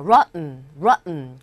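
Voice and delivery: ROTTEN with no audible T, falling; swallowed T. Shown as a demonstration. Teacher repeats it for learners, ROTTEN with the T unheard. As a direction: punch the first syllable of ROTTEN, swallow the T. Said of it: "트 발음이 안 들리고요".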